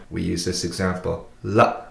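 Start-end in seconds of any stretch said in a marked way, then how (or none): 1.4-1.8 "La" cut off short by a glottal stop. Shown as a demonstration. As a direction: end the breath quickly on "La". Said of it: "the glottal stop sound".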